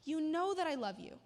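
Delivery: a touch of wounded insistence; hurt. Acted. Peter, hurt at the third question, protesting to Jesus. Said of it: "Peter was hurt that Jesus asked".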